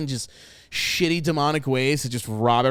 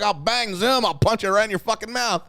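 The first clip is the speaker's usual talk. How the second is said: mock Ralph Kramden; 6 semitones higher, 5 dB louder than usual. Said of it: "he's literally like".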